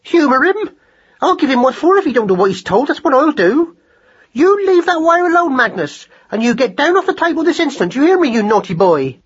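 very high, loud furious mother; outraged scolding. A narrator's reading. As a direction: tear into husband and child in a squeaky tirade. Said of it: "cried Madeline on a rising note".

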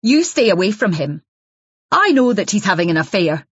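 hostile accusing wife. Acted. Jealous wife warns Isabel off her husband, raw and hostile. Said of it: "a rawness, voice began to be choked".

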